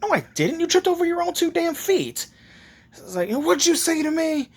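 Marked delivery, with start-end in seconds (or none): 0.0-2.3 high-pitched, exasperated denial; indignant retort. Acted. Naruto snaps back at accusing kid. 3.3-4.5 high, indignant comeback; affronted challenge. Acted. Kid challenges the retort.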